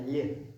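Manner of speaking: "lié" with a y-glide after the l; palatalized L. Shown as a demonstration. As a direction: soften "lié" into l plus y. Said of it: "on le palettise en turc". (« lié » palatalisé, avec un petit Y)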